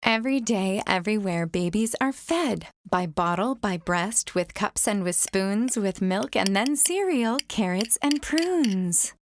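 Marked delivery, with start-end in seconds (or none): none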